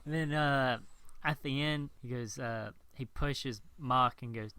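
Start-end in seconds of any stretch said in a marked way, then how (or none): none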